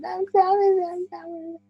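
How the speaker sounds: put-on tiny, very high child's wail; little girl. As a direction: fade away like a distant ghostly child. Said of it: "you hear a little girl going".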